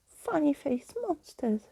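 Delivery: sudden high shriek of alarm. A narrator's reading. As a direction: shriek it as the monsters vanish. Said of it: "she shrieked".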